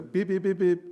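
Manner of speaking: short, clipped beeps like a horn; imitated car horn. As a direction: tap out the horn with your voice. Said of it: "pítale". (imitación de bocina, pitidos cortos)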